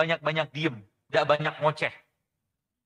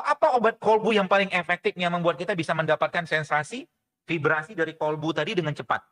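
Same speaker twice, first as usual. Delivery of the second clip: ordinary talk, nothing marked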